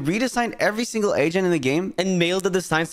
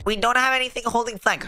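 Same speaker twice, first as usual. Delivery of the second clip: higher, mocking whine of a teammate; mock whiny teammate; 7 semitones higher than usual. Mocking viewers who complain, a higher teammate whine. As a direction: parrot the complaint back in a nasal-free mock whine. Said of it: "hearing you guys saying".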